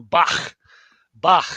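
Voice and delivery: Bach ending in a scraped fricative; guttural ch. Shown as a demonstration. Teacher showing the Chet sound, Bach with guttural ch. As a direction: say Bach with a throaty German ch. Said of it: "the guttural ch".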